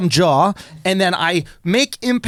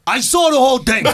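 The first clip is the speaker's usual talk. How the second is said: raised-pitch stranger announcing himself; bystander's voice; 4 semitones higher than usual. Retold or imitated. Stranger calling out after the smash, mock witness voice. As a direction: imitate an eager witness butting in. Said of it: "all I just hear is".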